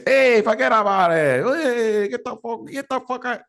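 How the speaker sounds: high, exaggerated New Yorker voice; cartoonish New York street talk; New York accent. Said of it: "It's a New York accent".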